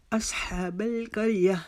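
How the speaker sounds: slow असहाबल करिया with a long "haa"; careful chanted model of असहाबल करिया; measured recitation. Shown as a demonstration. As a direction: stretch "haa" in असहाबल, keep l unreleased. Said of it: "इसको लंबा करेंगे".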